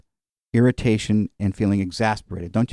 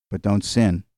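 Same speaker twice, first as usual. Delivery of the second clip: ordinary delivery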